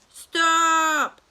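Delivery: a sudden piercing high shout; loud scream. Acted. Tia yelling at the man lifting her: loud high shout. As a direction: scream it to make him drop you. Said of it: "Tia crie".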